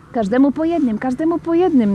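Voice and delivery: put-on high-pitched child hurrying things along; urging. A narrator's reading. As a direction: chivvy with an insistent, rising repeat. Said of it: "naganiał Tomcio". (ponaglający dziecięcy głos)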